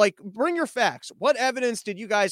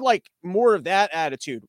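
ordinary talk, nothing marked

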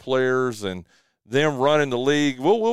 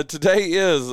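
ordinary delivery

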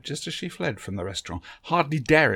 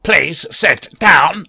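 very slow, louder, grating robot voice; slow metallic Dalek; 7 semitones higher, 15 dB louder, much slower than usual. A narrator's reading. The Dalek invites Barbara to sit, slow, loud and metallic. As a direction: grind each word out like a machine. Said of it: "in its slow metallic voice".

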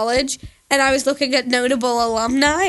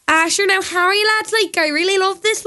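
high-pitched Dublin lilt; Southern Irish Dublin accent; 7 semitones higher than usual. Shown as a demonstration. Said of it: "Southern would be, like, Dublin".

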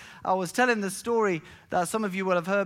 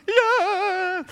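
relieved yell; 6 dB louder than usual. Retold or imitated. Relieved answer to the neighbour.

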